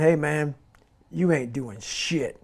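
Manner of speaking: low, quiet, slow verdict from the inner critic; quiet self-critical voice. Acted. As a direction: deliver a blunt truth softly and low. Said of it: "This other voice that we walk very far away from".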